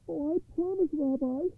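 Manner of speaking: high, eager promise; poor man's high pleading voice; troubled man. A narrator's reading. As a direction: answer high-pitched and anxious to obey. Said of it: "answered the troubled man".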